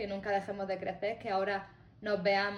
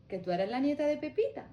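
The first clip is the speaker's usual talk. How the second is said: astonished lady; 7 semitones higher, 4 dB louder than usual. Retold or imitated.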